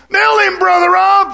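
the tempter shouting at the preacher to hit the man; shouted egging on. Retold or imitated. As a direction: yell it like the devil at your shoulder. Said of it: "a guy living over here".

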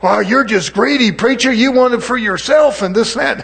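hammed-up scold of a greedy preacher; playacted accuser calling out the preacher; mock accusation. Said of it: "it's like".

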